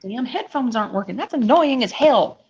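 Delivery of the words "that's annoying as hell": loud, exasperated complaint; annoyed. Real, not acted. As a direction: vent the annoyance loudly. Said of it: "I'm annoyed".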